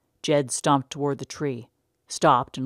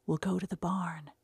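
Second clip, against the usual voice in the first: whispered; 3 semitones higher, 6 dB quieter than usual. Acted. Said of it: "she whispered".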